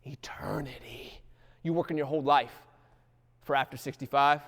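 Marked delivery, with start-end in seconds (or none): 0.1-1.2 slow breathy whisper of Eternity; hushed awe; whispered word. Real, not acted. Preacher whispers key word.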